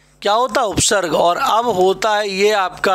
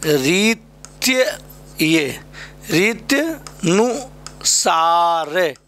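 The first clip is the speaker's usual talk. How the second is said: slow spelling-out; much slower than usual. Shown as a demonstration. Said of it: "यहाँ पे".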